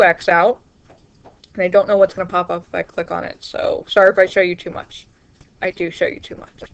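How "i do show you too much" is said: soft unvoiced whisper to herself; whispered aside. Real, not acted. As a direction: breathe it out as a sheepish aside. Whispered aside after seeing screen.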